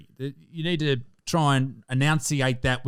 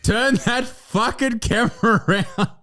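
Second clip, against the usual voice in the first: giggling through the words, slowed; laughing at the guest's upside-down camera; 9 semitones higher, slower than usual. Real, not acted. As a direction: try to give an order but crack up. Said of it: "Is something amusing?".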